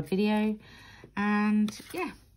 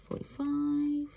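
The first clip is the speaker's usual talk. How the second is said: higher, drawn-out bingo call; bingo caller, higher; 8 semitones higher than usual. Retold or imitated. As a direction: call it again, pitched up. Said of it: "from the bingo lingo".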